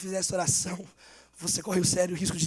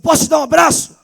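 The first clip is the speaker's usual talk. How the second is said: higher and louder, pressing the question; louder insistent offer; 6 semitones higher, 15 dB louder than usual. Retold or imitated. Girl repeating her offer of a hug to the boy, louder and higher. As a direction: ask again, lifting the voice to reach him. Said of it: "A menina falou".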